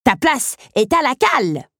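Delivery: high little pirate bellow; child's shout. A narrator's reading. Little teacup threatening the footstool, shouting. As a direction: order the enemy below in a high yell. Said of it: "cria Zip".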